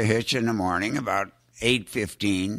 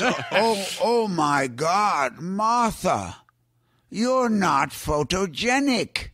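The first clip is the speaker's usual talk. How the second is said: Hitchcock imitation; 6 semitones higher, slower than usual. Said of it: "I won't do his imitation".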